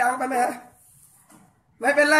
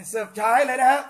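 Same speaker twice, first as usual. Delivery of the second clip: ordinary talk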